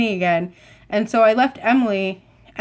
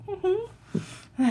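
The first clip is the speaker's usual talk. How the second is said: tiny, high, soft hum of assent; nervous; 11 semitones higher, 10 dB quieter than usual. Retold or imitated. Nervous fan admitting it to the character, meek squeak. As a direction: squeak out a meek little yes. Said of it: "you make me nervous".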